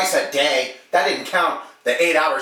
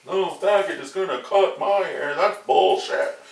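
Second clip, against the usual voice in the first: imitating the customer's angry rant, slow at the end; retold yelling; 6 semitones higher, slower than usual. Customer yelling at the barber in a crowded salon, mock angry rant. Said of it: "here's this guy yelling at me".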